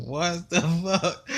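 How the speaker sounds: very slow, drawn-out astonishment; retold incredulous reaction, each word lingering; mock disbelief. Retells own stunned reaction.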